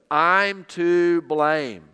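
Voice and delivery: slow, weighty I'm to blame; slow, weighty confession. Shown as a demonstration. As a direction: stress every word of I'm to blame, slowly and gravely. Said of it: "underline these words, circle them".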